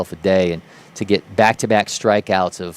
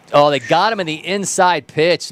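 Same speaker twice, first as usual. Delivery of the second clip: higher-pitched live reaction to the pitch; excited strikeout call; 7 semitones higher, 3 dB louder than usual. Real, not acted. Commentator reacting to an inside-pitch strikeout, excited.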